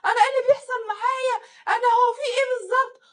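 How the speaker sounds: high, loud, fast wailing; mock hysterical screaming. Wife screaming and slapping her face, mock hysterics. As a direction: imitate a wife shrieking in panic. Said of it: "وقعدت تصوت وت".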